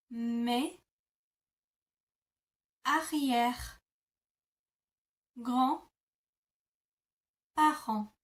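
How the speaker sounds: mes, arrière, grand, parents each said alone with long pauses; slow word-by-word demo. Shown as a demonstration. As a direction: pause after every word. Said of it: "break that down even further".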